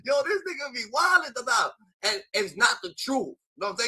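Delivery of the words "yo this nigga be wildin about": mock laughing disbelief. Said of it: "[name]'s over here dying in his head like".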